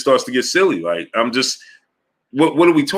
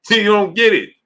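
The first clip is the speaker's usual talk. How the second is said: exasperated; 7 semitones higher, 4 dB louder than usual. Real, not acted. Repeats louder, exasperated.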